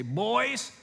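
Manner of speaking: high, lilting mom voice; high-pitched, emphatic motherly assurance; imitated mom. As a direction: mimic a mother lecturing her boys. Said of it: "That's exactly how she talks".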